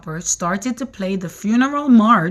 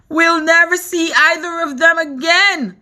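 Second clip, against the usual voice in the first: crying out; 8 semitones higher, 4 dB louder than usual. A narrator's reading. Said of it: "cried the ladybug".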